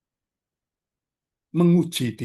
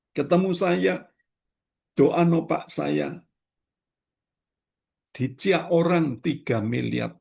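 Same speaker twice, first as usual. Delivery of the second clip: ordinary talk, nothing marked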